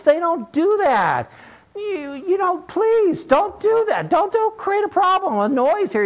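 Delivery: put-on high, pleading, exasperated old man; old man losing it. Old fisherman begging the yelling boy to stop, high and exasperated. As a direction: beg the boy to hush, voice climbing high. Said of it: "the old man lost it".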